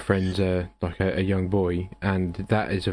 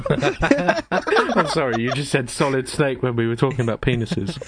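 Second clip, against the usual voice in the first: laughing; 4 semitones higher than usual. Real, not acted. Laughing at the solid snake joke.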